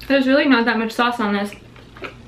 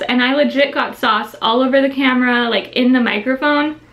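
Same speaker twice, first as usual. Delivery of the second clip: ordinary delivery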